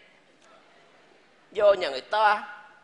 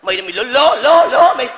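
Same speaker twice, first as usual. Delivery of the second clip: angry outburst; 7 dB louder than usual. Acted. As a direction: explode at the suitor, stammering louder. Father explodes at the name. (quát lên giận dữ)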